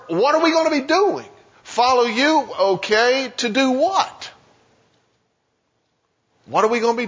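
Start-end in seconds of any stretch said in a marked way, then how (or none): 0.1-1.3 much higher, incredulous question; doubtful disciple. Acted. Voices disciple's worried question. 1.6-4.3 higher, disbelieving question in a put-on voice; incredulous disciple.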